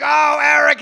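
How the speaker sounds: high loud outraged cry; hammed-up shocked yell; shocked shout. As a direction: call him out in shock. Catching roommate in the act.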